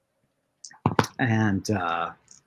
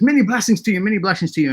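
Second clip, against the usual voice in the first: grateful blessing; 7 semitones higher, much faster than usual. Acted. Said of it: "He goes".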